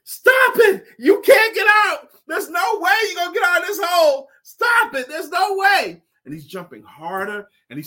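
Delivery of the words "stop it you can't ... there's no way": very high, loud shouting; frantic shouting down into the hole; frogs yelling. Acted. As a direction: scream at him to give up. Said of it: "And they're yelling".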